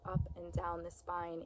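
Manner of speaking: ordinary delivery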